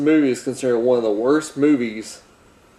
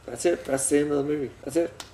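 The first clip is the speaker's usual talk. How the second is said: much softer, dropped right down; quiet anticlimax; 10 dB quieter, faster than usual. Real, not acted. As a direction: let it peter out softly. Deadpan summary of abrupt ending.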